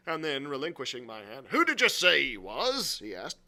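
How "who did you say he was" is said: loud, raised-pitch question; demanding innkeeper. Acted. Silver questions the boy about the runaway, demanding. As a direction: demand an answer, loudly.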